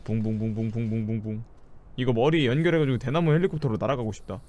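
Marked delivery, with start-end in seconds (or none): 0.0-1.4 low buzzing vroom; engine noise. Pretend. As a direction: hum like a little engine. Imitating flying vehicle.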